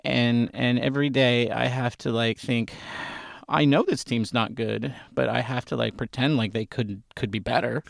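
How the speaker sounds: confessing sadness about the Giants to his brother; mock sadness. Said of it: "I'm actually sad".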